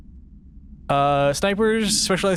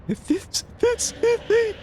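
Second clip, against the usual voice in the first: much higher gasping in-breath voice; talking while inhaling; much slower than usual. Shown as a demonstration. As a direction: squeeze words out on the in-breath. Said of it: "switch to talking by inhaling".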